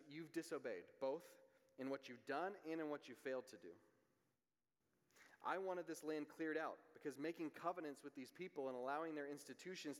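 preaching at full force; voicing God's rebuke.

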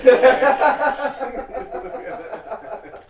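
high loud laugh; loud burst of laughter. Real, not acted. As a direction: crack up loudly. Big laugh at the joke.

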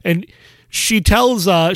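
ordinary delivery

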